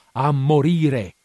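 loud, drawn-out repeat; slow, loud, exultant repeat; slow shout. A narrator's reading. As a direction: stretch each syllable in a manic cry. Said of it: "gridò".

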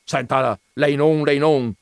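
loud, high cutting-in shout. Acted. Veneranda shouting over the stammering clerk, loud. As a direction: shout him down. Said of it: "gridò il signor Veneranda".

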